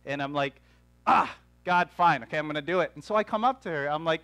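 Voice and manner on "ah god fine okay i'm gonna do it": retold grudging surrender, loud groaned start; exasperated giving in. Preacher replaying how they gave in to God, exasperated. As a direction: give in with a loud mock sigh, then settle.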